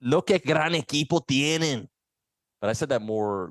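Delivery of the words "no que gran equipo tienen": Spanish with a strong Cuban accent; slow, lifted Cuban Spanish. Shown as a demonstration. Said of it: "in your Cuban accent".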